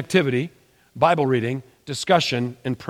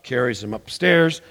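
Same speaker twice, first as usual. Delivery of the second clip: ordinary talk, nothing marked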